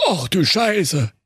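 slow, high shocked mutter; dismayed surprise at the pulse. A narrator's reading. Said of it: "sprach er nach einer Weile".

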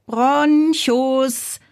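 high, drawn-out call downstairs. A narrator's reading. Bazilla calls her brother downstairs, calling out. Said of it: "rief Bazilla laut nach unten".

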